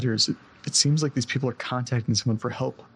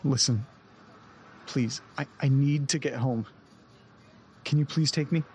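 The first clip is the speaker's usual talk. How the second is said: desperate pleading. Acted. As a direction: plead with him, close to breaking. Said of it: "calm down".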